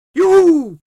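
very high, very loud hoot; whooping screech. A narrator's reading. Karel answers meneer Pen from the air, whooping screech. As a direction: screech a long carefree reply. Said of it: "riep Karel".

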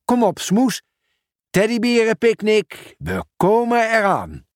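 eager, high and lifted; excited. Acted. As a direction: call it out with glee. Pieter cheers setting off.

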